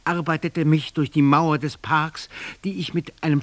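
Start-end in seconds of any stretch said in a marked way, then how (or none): none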